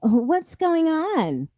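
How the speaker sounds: slow, drawn-out "What's going on?"; lightly voiced dopey mom; mocking Charlene. Imitating Charlene asking what's happening, slow mock. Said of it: "dumbass Charlene".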